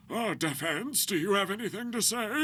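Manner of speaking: put-on high, creaky old judge. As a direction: play a doddering old judge. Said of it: "he does this voice".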